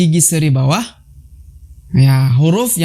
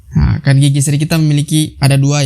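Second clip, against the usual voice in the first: normal talking voice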